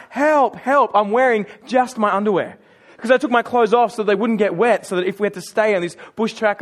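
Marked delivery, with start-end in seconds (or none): 0.1-0.9 mild, raised mock yell; a half-voiced cry for help, pitched up; retold scream for help. Stranded man screaming out after a four-wheel drive, mild mock yell. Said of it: "screaming out".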